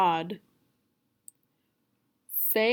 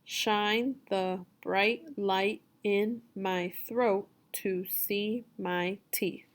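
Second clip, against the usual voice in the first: very slow, each word set apart; word-by-word reading; much slower than usual. Shown as a demonstration. Teacher reading for a student to repeat, word by word. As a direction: read it slowly, pausing after every word. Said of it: "You just repeat after me".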